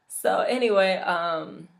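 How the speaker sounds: ordinary delivery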